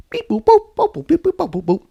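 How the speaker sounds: high beep boop dialing noises; mouthed phone beeps. Acted. Bus dialing the school, mimicking keypad tones. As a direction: dial the phone with your mouth.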